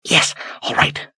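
whispered, breathless gasp. Acted. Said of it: "she gasped".

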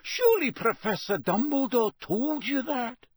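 high-pitched flustered professor; high, disbelieving question; dismayed protest. Acted. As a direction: plead for confirmation, aghast. McGonagall protests, still shocked.

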